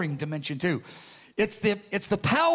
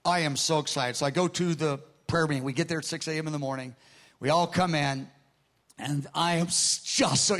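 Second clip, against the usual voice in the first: ordinary delivery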